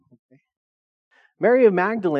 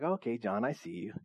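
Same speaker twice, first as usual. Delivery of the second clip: soft, knowing "I see you" to John; much softer knowing aside; teasing aside; 6 semitones lower, 13 dB quieter than usual. Retold or imitated. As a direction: tease him quietly, like sharing a joke.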